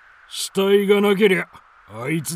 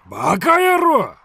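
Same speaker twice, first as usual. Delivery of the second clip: 一喝; 9 semitones higher, 6 dB louder than usual. Acted. 手下を一喝する頭目.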